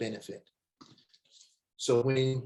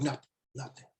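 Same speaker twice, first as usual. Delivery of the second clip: quiet hurt mumble; 8 dB quieter, faster than usual. Retold or imitated. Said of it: "He was like".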